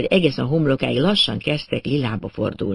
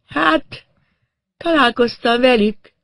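high, halting dazed voice; stunned, pitched far up; woman in shock; 10 semitones higher, slower than usual. A narrator's reading. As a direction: speak as if not quite conscious. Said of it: "rendes sokkot kapott odakint".

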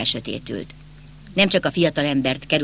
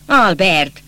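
loud call toward the door; summoning the butler, loud and impatient; calling loudly; 3 semitones higher, 5 dB louder, much slower than usual. A narrator's reading. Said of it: "erős hangon kiszólt".

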